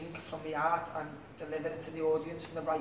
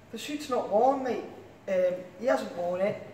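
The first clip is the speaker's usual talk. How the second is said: put-on a light Manchester accent, a bit louder and higher; 3 semitones higher, 4 dB louder, slower than usual. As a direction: do the Manchester lad, mildly indignant. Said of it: "Can I do a Manchester accent?".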